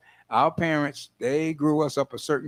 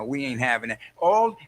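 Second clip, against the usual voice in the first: usual speaking voice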